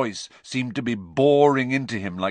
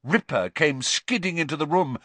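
ordinary delivery

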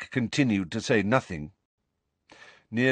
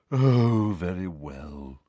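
slow, sinking sigh of consent; resigned; 3 semitones lower, much slower than usual. A narrator's reading. Ghost gives in to Harry's request, resigned. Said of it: "looking resigned".

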